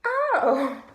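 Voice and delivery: loud, high stunned oh; shocked oh. Retold or imitated. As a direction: blurt it out floored. Shock at learning she's the girlfriend.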